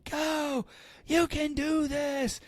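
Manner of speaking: high, strained cheering yell at normal volume; mock scream. Cheering the workers on from God's shoulder, mock scream. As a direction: scream encouragement without full volume. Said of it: "I'm screaming".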